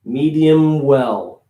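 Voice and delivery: "medium well" said slowly with the M of "medium" held long; carried M. Shown as a demonstration. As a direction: hold the M of "medium" before opening to the vowel. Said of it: "Carrying that M".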